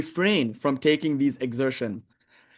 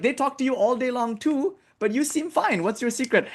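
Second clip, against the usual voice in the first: put-on pleading young psychiatrist; 9 semitones higher than usual. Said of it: "and he said".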